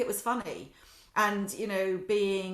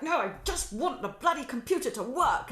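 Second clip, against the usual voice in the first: higher, louder exasperated complaint; frustrated outburst; 6 semitones higher, 4 dB louder than usual. Retold or imitated. Imitating her own frustration at the computer, higher and louder. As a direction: vent at the broken computer, exasperated.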